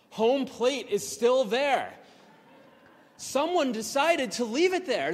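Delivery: thrilled, awed proclamation; joyful discovery. Real, not acted. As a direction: announce the miracle. Finds home plate still there.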